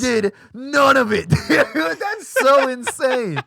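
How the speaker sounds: loud, high, drawn-out disbelief; real exasperated, laughing shout; incredulous outburst. Real, not acted. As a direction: shout it slowly, pitch way up, breaking into laughter. Incredulous rant about Madoff.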